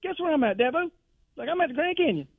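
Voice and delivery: high, excited coach voice, calling out the name; a caller thrilled to reach Dabo. Retold or imitated. Coach calling Dabo from the canyon, high and excited. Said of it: "And he's like".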